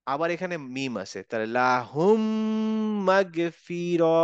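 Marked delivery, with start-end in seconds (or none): 1.3-4.2 লাহুম মাগফিরা with the two m joined and held, very slow; merged mim. Shown as a demonstration. Teacher demonstrating idgham to students. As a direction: join the m of লাহুম into মাগফিরা with a nasal hold. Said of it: "মিমের সাথে মিম কিন্তু join".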